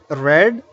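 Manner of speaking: "read" with a short e, like "red"; past participle pronunciation. Shown as a demonstration. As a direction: say "read" to rhyme with "bed". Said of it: "and the past participle form".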